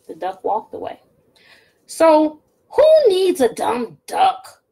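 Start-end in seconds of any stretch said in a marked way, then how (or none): 1.9-4.6 witch mumbling. Acted. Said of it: "Gritch mumbled".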